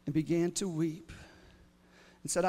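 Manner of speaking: ordinary delivery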